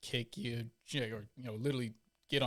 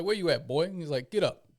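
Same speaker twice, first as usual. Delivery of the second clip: loud, higher drill instructor yell; instructor barking orders; 6 semitones higher, 8 dB louder than usual. Retold or imitated. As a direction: bark it at the recruit like a drill instructor. Drill instructor confronting recruit.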